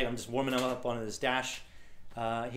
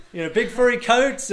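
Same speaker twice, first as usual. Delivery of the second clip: high, loud mock disbelief; incredulous driver; 9 semitones higher, 9 dB louder than usual. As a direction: balk loudly at an absurd plan. Driver questions his lack of gear.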